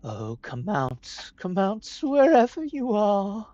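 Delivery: creepy sing-song taunt, rising high; slow, menacing sing-song call. Acted. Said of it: "The Sandwing spoke in a sing-song voice".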